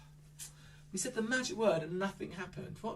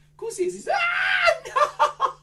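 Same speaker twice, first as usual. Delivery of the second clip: excited shout; 8 dB louder than usual. Acted. Children's entertainer puppet show.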